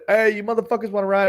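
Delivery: high, quick street holler; high-pitched pretend yell to passersby; mock yell. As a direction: re-enact the hawker's call without full volume. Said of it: "I yell".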